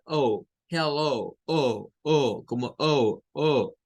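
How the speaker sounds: "oh" as a glide from O to U; long O ending. Shown as a demonstration. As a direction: round the end of "hello" into "oh". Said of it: "Y vean que acá dice".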